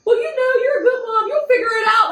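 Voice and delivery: high, crying reassurance; cousin comforting. Retold or imitated. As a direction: do the cousin consoling through tears.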